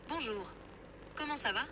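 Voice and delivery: French-accented greeting. Shown as a demonstration. AI assistant tries another for the user, French accent. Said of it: "How about a French accent?".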